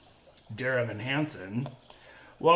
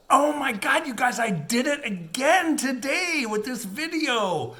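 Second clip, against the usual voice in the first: excited; 10 semitones higher, 3 dB louder than usual. Real, not acted. Confessing another video mistake.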